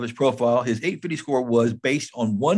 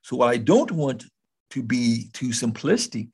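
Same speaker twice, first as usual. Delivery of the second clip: usual speaking voice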